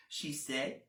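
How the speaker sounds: ordinary delivery, nothing marked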